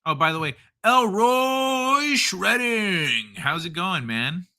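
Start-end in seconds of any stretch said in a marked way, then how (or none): 0.8-3.3 high, stretched-out shout-out of a name; drawn-out greeting holler. Real, not acted. Host hailing a viewer by name, high drawn-out call.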